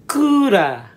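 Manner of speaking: "Kura" as long koo, tapped ra, high and loud; Japanese pronunciation. Shown as a demonstration. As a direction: demonstrate "Kura" loudly the Japanese way. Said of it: "it's pronounced".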